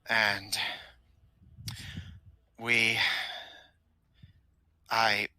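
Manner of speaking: lightly voiced loyal minion, groping for memories; halting, with long pauses as memory fails; henchman recalling.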